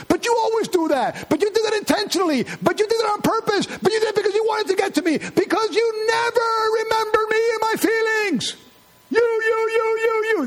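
shrill accusing rant. Acted. Spouse shrieking accusations in an argument. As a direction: pile on the accusations until you shout.

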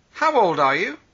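usual speaking voice

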